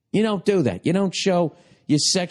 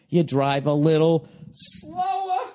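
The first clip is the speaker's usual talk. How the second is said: slow repeat, stretching out "slower"; drawn-out emphasis; slower than usual. Real, not acted. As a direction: drag the word "slower" right out.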